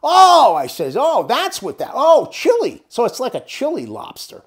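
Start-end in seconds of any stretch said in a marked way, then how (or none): 0.0-0.5 a high, loud oh of sudden understanding; dawning realization. Retold or imitated. Realizing Ed meant the country, loud surprised oh. 1.0-2.8 oh, chili said higher and louder, pitch falling as it clicks; the light bulb goes on; dawning realization. Retold or imitated. Finally getting that Ed means Chile, loud realization.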